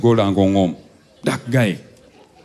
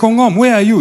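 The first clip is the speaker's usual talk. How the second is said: calling out; 10 semitones higher, 4 dB louder, faster than usual. Retold or imitated. Dad calling on conference.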